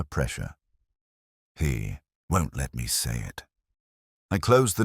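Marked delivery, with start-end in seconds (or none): 1.6-3.4 low, soft read of a desperate note; quiet despair. A narrator's reading. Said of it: "the quiet despair of the wording".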